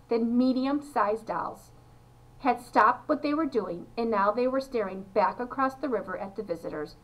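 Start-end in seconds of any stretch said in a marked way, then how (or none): none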